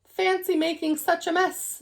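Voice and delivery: admiring. Acted. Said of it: "said Jonathan admiringly".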